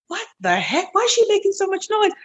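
high, fast, bewildered; dazed surprise. Retold or imitated. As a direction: wake up annoyed by the noise. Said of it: "And I went".

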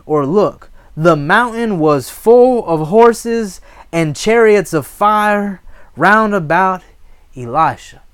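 loud, high scripture proclamation; forceful preaching. Acted. Preacher revealing the horses and chariots of fire, triumphant preaching.

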